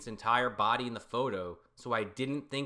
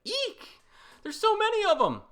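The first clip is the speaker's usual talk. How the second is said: high squeal, hammed-up; mock frightened shriek; 8 dB louder than usual.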